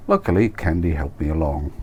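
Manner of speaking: ordinary delivery, nothing marked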